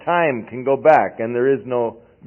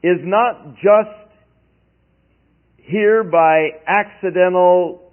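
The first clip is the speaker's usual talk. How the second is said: slow emphasis; 4 semitones higher, 4 dB louder, slower than usual. Real, not acted. Preacher stressing key point.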